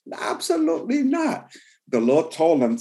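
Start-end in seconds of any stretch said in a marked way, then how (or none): 0.1-1.5 high, emphatic denial; sharp high answer to the crowd. Real, not acted. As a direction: shut it down hard. Preacher answers own question.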